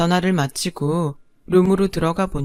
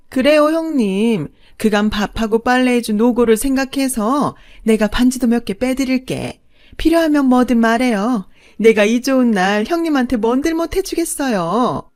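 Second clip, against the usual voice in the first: gloating mock sweetness; 5 semitones higher, 3 dB louder than usual. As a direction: rub it in with false kindness. Second mother gloats sweetly. (높고 느리게 빈정대는 둘째 어머니)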